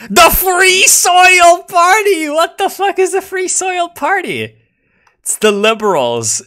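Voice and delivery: very high, loud slow squeal; high falsetto outburst; excited shriek. Real, not acted. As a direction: shriek the name in delight. Shrieking at party name.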